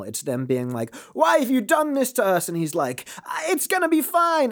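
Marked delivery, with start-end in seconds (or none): 1.1-2.5 high-pitched mock anguish; emotional pleading. Israelites plead with Moses, mock emotional. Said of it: "is very emotional". 3.2-4.5 mock reassurance. Moses answers the emotional people.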